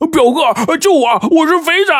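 high-pitched overjoyed cry for help; 惊喜呼救. A narrator's reading. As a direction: call out desperately to a cousin in delighted relief. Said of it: "他就不由得大喜啊". (又惊又喜地高声呼救)

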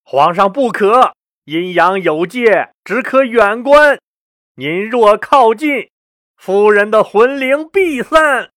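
high, slow, drawn-out incantatory warning; solemn sorcerer holding the emperor back; warning conjurer. A narrator's reading. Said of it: "李少翁赶紧出言阻拦".

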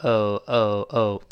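American "哦", one blended oh; American diphthong. Shown as a demonstration. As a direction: say "哦" as a single American oh. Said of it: "美国人燃到一块儿".

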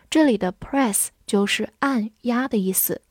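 usual speaking voice

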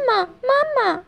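high-pitched little duckling voice; duckling calling out. A narrator's reading. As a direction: cry out eagerly like a tiny hatchling. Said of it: "叫着".

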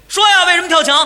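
警察高声喝问; 拔高嗓门逼人开口; 警察逼问. Retold or imitated. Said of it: "警察这儿还紧着逼我". (警察大声逼问)

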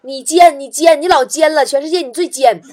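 punchy, shouted teasing accusation; rhythmic, full-volume ribbing; loud mock scolding. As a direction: hurl it out loudly, hammering each jab.

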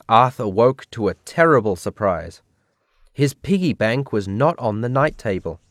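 plain reading voice

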